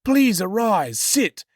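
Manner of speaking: hammed-up impostor son; Jacob posing as Esau.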